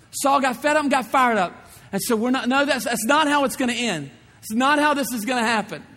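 loud, high, fast preacher's climax; fiery preaching. Acted. Preaching at full force.

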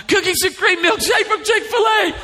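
very high-pitched mock wail; blurted-out wail. Daughter demanding a treat after surgery, high blurted wail. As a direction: blurt it out like a wailing teenager. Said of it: "she just blurted out".

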